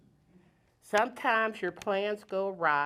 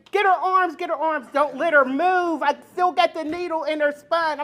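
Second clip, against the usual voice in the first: higher, louder urgent commands; urgent doctor's orders; 8 semitones higher, 5 dB louder than usual. Retold or imitated. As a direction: bark orders like a doctor in a rush. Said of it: "the doctor is saying".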